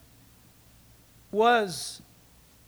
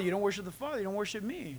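much softer mirrored line; quiet echo; 8 dB quieter, much faster than usual. Acted. Jesus repeats the warning, softened to near quiet. Quoting Jesus, echoed softly.

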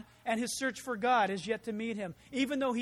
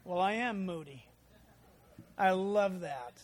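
ordinary talk, nothing marked

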